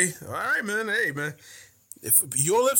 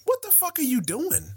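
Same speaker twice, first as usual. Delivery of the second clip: incredulous; 12 semitones higher than usual. Real, not acted. Repeated higher, incredulous.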